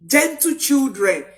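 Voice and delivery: ordinary delivery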